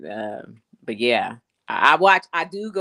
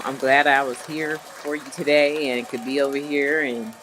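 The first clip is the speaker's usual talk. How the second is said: ordinary delivery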